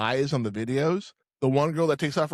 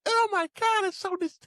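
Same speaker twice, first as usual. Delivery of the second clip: much higher squeal of disgust; girl's disgusted squeal. Retold or imitated. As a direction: shriek it like a grossed-out girl. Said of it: "And she's like".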